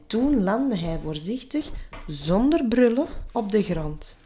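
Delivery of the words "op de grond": ordinary delivery, nothing marked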